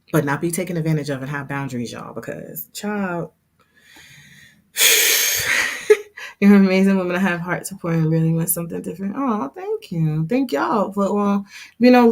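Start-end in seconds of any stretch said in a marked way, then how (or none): 4.8-6.4 long sigh. Real, not acted. Heartfelt pause in live chat.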